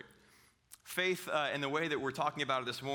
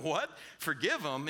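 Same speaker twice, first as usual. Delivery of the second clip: incredulous disciples. Retold or imitated. Disciples reacting to Jesus's command, mock incredulous. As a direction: balk at the idea, questioning. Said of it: "the disciples are sitting there going".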